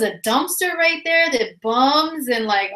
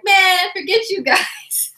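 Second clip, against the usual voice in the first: higher, slow, indignant brush-off; mock outrage; 8 semitones higher, slower than usual. Teased by coworkers about sweet thing, mock indignant. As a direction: wave off the teasing friends.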